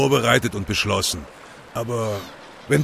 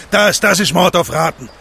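louder and higher, accusing hard; angry outburst; 4 semitones higher, 7 dB louder than usual. Acted. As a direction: hurl the accusation at him, building in outrage. Activist accuses Leon over whales.

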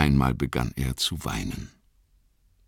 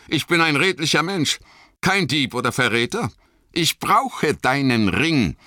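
lightly voiced Boromir, indignant and higher; redlich beteuernder Boromir; 10 semitones higher than usual. A narrator's reading. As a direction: protest your honesty with mounting urgency. (empört und eindringlich beteuernd)

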